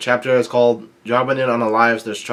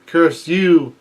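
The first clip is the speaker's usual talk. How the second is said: higher-pitched mock yell, a little louder; retold yell; 6 semitones higher, 4 dB louder than usual. Daifuku cursing the little rabbit, retold yell. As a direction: cry it out as a retold curse. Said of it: "Daifuku yells out".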